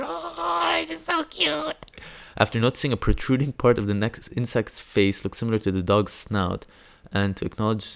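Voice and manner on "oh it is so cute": very high, squeaky cooing; tiny high-pitched voice melting with delight; gushing squeal. Real, not acted. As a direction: squeal over something adorably cute. Gushing over the pet dog name.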